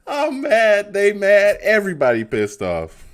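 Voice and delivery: loud, high and laughing; fed-up fan yelling to chat; loud shout. Real, not acted. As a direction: holler it out. Shouting about angry callers.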